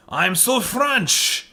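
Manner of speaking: slow, hammed-up French accent; mock French accent. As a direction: lay on a cheesy French accent, slowly. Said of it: "didn't even do a French accent".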